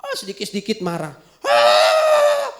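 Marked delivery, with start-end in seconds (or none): 1.5-2.5 high shouted "ah" that rasps the throat; pitched-up strained cry; hoarse yell. Acted. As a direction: shout till your voice cracks. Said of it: "sampai serak gitu ya".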